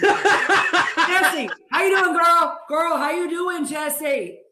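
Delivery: high, loud, drawn-out Texas impression; big hollered Texas greeting; [name]. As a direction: put on a loud, high Texan woman's voice. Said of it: "she's gonna do an impression for you".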